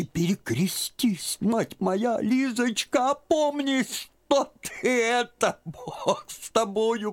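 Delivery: put-on very high, slow, tearful old woman; babbled in shock. A narrator's reading. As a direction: stammer it out, near tears. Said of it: "пролепетала она наконец". (тётка в ужасе лепечет Лизе, сквозь слёзы)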